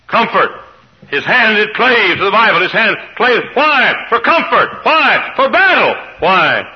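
high, loud shouted preaching; full-force preaching. Real, not acted. To the congregation, a loud, high preaching climax. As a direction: hammer each why home.

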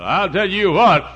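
mock the snob who looks down; preacher mocking snobs in church; snobbish churchgoer. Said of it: "some of you snobs, you say".

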